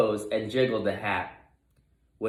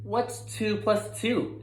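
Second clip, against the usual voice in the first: teacher voice; 10 semitones higher, slower than usual. A narrator's reading. Teacher voice asks class.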